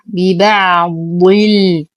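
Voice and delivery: ببعض الكتاب with the ع held and a long ا in الكتاب; slow tajweed recitation. Shown as a demonstration. Teacher recites the phrase for students, slow measured chant. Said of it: "أصبر على العين".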